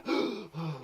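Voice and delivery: sharp, startled intake of breath; put-on shocked gasp; demonstrated gasp. Shown as a demonstration. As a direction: gasp as if made to jump. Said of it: "Gasped my air".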